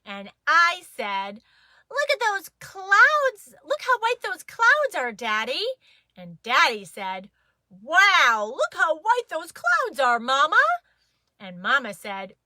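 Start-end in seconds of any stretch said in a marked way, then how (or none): 1.9-5.6 put-on excited child. A narrator's reading. Child narrator excited to Daddy. 7.7-10.6 big, loud, higher Daddy wow; Daddy marvelling at the clouds, loud; Daddy's voice. A narrator's reading.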